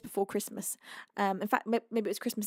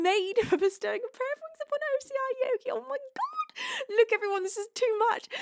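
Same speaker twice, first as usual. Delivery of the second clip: overwhelmed squeal; 12 semitones higher, faster than usual. Real, not acted. Overwhelmed by gifted subs.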